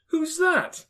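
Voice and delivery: high, loud startled question; startled cry. A narrator's reading. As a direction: demand to know in sheer surprise. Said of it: "cried Fred".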